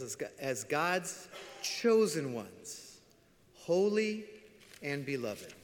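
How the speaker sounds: slow, weighty reading of the verse; solemn scripture reading. Acted. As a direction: lay each word down slowly. Reads scripture slowly.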